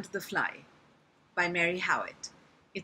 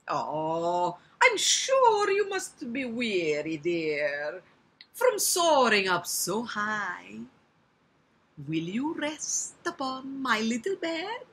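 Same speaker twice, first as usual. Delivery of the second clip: cunning Italian spider, drawn out and loud; Italian accent; 2 semitones higher, 4 dB louder, slower than usual. Acted. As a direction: coax slowly in an Italian accent. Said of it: "I can make him Italian".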